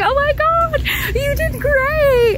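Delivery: very high, slow, squeaky praise; high gushing girl voice. Retold or imitated. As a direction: squeal it like an impressed stranger. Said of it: "She's like".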